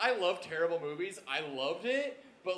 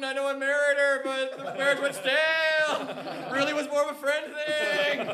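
high, hammed-up lament over a lost love; mock wistful confession; 7 semitones higher, 3 dB louder than usual. Player voicing JD mid-jump to the table, mock heartbroken. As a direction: sigh it out like a regretful ex-husband, higher. Continues JD's wistful midair musing.

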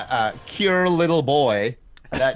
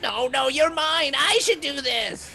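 witch voice; 11 semitones higher than usual. Acted. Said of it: "And the witch is like".

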